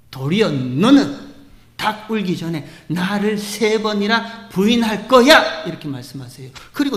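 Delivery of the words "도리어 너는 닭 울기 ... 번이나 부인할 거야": stern Jesus. Acted. Jesus voiced rebuking Peter.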